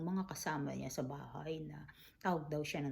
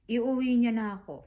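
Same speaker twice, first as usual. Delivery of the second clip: raised, louder mock complaint from an upset old mother; imitating angry mother; 6 semitones higher, 10 dB louder than usual. Mother on the phone angrily ordering her child, louder. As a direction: play the furious mother demanding her child act. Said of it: "galit na galit siya kay Josie".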